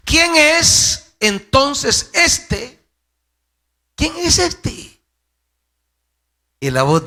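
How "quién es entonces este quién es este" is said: high-pitched, insistent questioning; dramatic high-voiced scripture quote; Herod demanding. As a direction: ask it as a baffled ruler, pitched way up. Acts Herod asking, quoted from scripture.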